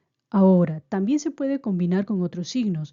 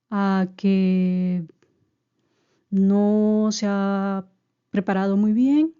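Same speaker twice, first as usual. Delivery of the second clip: rhetorical question; 4 dB louder, much slower than usual. Shown as a demonstration. Teacher demonstrating a rhetorical question.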